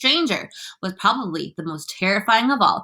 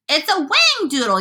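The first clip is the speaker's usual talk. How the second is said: high excited yell; 8 semitones higher, 4 dB louder than usual. A narrator's reading. Fire chief names the monster, yelling. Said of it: "yelled the head of the fire department".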